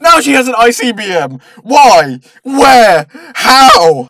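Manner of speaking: loud incredulous questioning; baffled outburst. Acted. Reviewer demands sense from a plot twist, baffled outburst. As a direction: shout each question in exasperated bafflement.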